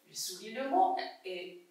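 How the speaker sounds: usual speaking voice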